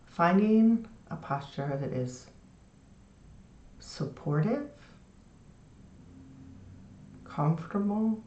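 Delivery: soft, unhurried meditation guide; guided meditation. Shown as a demonstration. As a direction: settle them gently, pausing between phrases. Leads a lying-down meditation.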